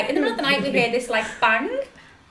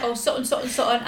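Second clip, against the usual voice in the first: usual speaking voice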